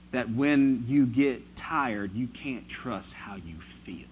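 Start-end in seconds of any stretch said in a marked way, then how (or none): 2.1-4.1 breathy hushed whisper, drawing them close; whispered warning. Acted. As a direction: let it fall to a whisper. Preacher drops to whisper for emphasis.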